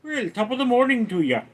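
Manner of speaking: high, lilting Irish greeting; Irish accent. Shown as a demonstration. Streamer showing chat an Irish accent, high put-on greeting. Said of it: "what about an Irish accent?".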